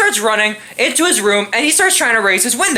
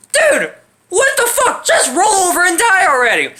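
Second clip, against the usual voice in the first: exasperated outburst; 8 semitones higher than usual. Pretend. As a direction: yell at the screen in disbelief. Audience reacting to endless groaning.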